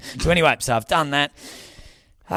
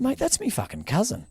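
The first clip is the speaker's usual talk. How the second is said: higher, pleased exclamation; excited reply; 10 semitones higher than usual. Retold or imitated. Comedian telling the MC about his cousin, higher excited tone. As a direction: announce it with glee. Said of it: "And I said".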